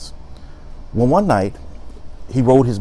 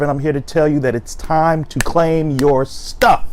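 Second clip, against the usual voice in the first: upbeat, punchy intro that climbs higher and louder; energetic host intro; 4 semitones higher, 3 dB louder than usual. Acted. Host greeting his viewers with a higher, louder hype intro. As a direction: hype the viewers up for story time.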